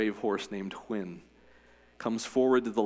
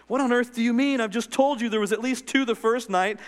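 put-on high, loud, incredulous boy; a boy who can't believe it; boy protesting; 11 semitones higher, 5 dB louder than usual. A narrator's reading.

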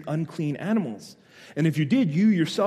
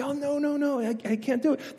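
higher, pleading refusal; pained refusal; 8 semitones higher than usual. Acted. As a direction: turn them down in a high, apologetic voice. Acted refusal to desperate people.

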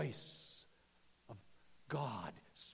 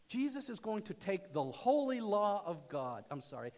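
usual speaking voice

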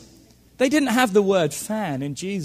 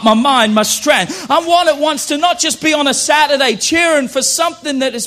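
loud, fervent declaration of faith; fiery preaching; 5 semitones higher, 9 dB louder than usual. Acted. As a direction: preach it out proudly to the crowd. Preacher builds to loud climax.

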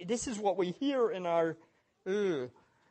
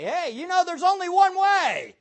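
louder, higher warning shout; bold proclamation; 8 semitones higher, 8 dB louder than usual. Acted. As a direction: call it out boldly to the crowd. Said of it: "finally say".

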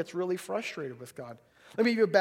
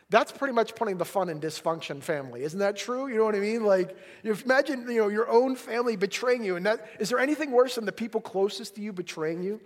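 ordinary delivery

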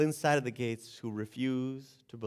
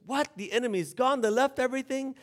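surprised king; 9 semitones higher than usual. Retold or imitated. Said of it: "the king got up".

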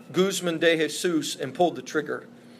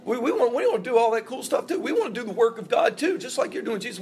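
higher, fast, eager asking; eager pleading; 6 semitones higher, faster than usual. Retold or imitated. People begging Jesus eagerly.

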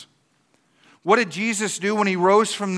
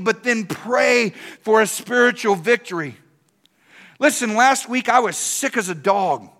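high, emphatic preacher's delivery; forceful preaching; 6 semitones higher, 4 dB louder than usual. Real, not acted. Pastor urging the congregation to pray and follow promptings, forceful preaching. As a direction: drive each point home to the congregation.

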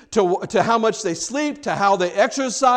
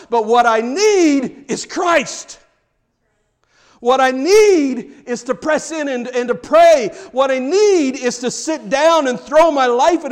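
loud rising sermon climax, pressing each phrase; full-force preaching; 4 semitones higher, 5 dB louder than usual. Acted. Pastor urging the congregation toward Christ, loud and fervent. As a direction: preach it out to the back row.

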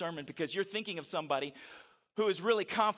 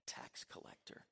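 quiet smug Pharisee; 7 semitones lower, 9 dB quieter than usual. Acted. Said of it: "he points and he says".

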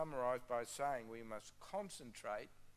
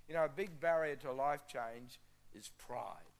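normal talking voice

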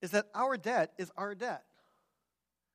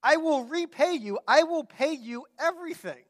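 high, loud, emphatic promise; mocking plea; 6 semitones higher, 9 dB louder than usual. Preacher requotes the servant's pathetic promise, mock pleading. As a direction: overplay the servant's earnest pledge.

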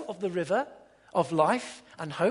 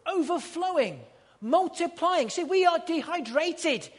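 higher, louder preached list; preaching; 8 semitones higher, 4 dB louder than usual. Acted. Preacher to congregation, ringing proclamation. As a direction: proclaim each word.

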